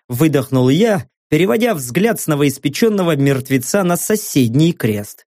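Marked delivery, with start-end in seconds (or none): none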